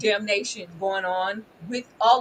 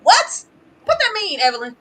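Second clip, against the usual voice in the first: mock disbelief, much higher and louder; incredulous retort; 7 semitones higher, 7 dB louder than usual. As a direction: act stunned at the news.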